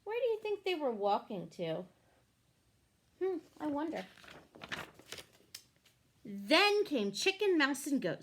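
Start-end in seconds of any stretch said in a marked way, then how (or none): none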